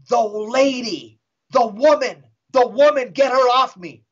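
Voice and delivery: pleading outcry, hammed up a little; possessed voice. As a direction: imitate a demon crying out in pain. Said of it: "the voice wasn't her voice".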